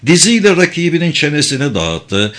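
usual speaking voice

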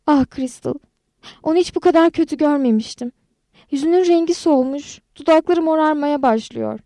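high, alarmed voice. A narrator's reading. Narrator to Crystal over an unconscious friend, alarmed. Friend found collapsed, barely breathing.

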